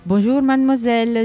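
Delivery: usual speaking voice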